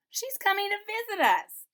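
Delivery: put-on high, loud child's shout. A narrator's reading. Second child announcing grandma's visit, high shout. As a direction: shout the good news. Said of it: "shouted the other".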